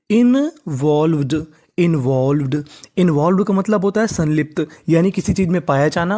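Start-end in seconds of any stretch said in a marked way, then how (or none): 0.1-2.6 involved stretched out very slowly and carefully; slow teaching demo. Shown as a demonstration. Teacher to students, slowly sounding out a word. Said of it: "तो meaning बनी".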